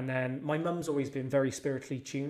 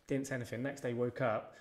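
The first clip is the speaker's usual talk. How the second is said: normal talking voice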